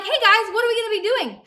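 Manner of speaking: high, loud, overkeen kid imitation; mock eager question. Middle schooler asking high schoolers the plan, hammed-up eager. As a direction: pipe up like a keen tagalong.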